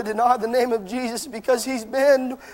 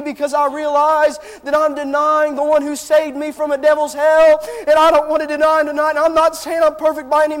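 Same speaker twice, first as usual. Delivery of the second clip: louder, climbing preacher's plea; impassioned preaching; 3 semitones higher, 4 dB louder than usual. Real, not acted. Preacher confessing his sorrow to the church, fervent rising preaching. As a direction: push the sorrow of denial up to a cry.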